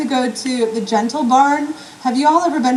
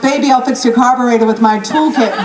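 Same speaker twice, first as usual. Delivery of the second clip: ordinary talk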